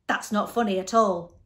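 loud, hurt protest with little real sobbing; mild mock sob. A narrator's reading. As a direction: protest like an offended big baby. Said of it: "sobbed the Din".

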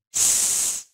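long unvoiced hissed "S"; S sound. Shown as a demonstration. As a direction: bite the teeth together and push air out on "S". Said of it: "cắn răng vào". (âm "S" cắn răng, đẩy hơi ra)

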